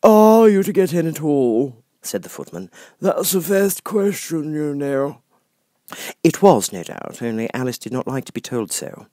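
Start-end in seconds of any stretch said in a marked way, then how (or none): 0.0-1.8 high, slow, lofty footman voice; frog footman. Acted. Frog footman dismissing Alice at the door, lofty. 3.0-5.2 high, slow, lofty footman voice; frog footman. Acted. Frog footman dismissing Alice at the door, lofty.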